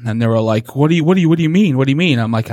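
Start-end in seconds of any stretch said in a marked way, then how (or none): 0.8-2.2 higher and louder, frantic repeated questions; panicked victim. Retold or imitated. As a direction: blurt it out in rising panic. Terrified couple questioning the killer.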